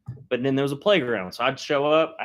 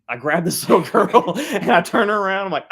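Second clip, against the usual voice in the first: ordinary delivery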